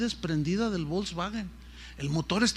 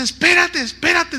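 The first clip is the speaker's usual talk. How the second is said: much higher, louder urgent stop; overwhelmed plea; 11 semitones higher, 9 dB louder than usual. Retold or imitated. Moved nurse urgently asks the preacher to stop. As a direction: beg him to pause, overcome.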